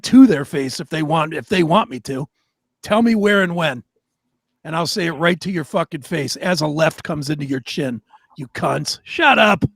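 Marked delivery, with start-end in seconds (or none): none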